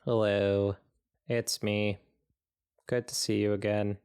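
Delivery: ordinary talk, nothing marked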